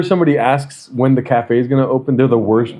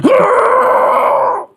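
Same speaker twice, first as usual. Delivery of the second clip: long, high strained mock groan; struggling groan; 3 dB louder than usual. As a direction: imitate a noisy struggle to get up. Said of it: "He struggles getting up".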